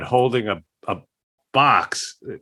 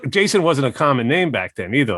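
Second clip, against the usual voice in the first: ordinary talk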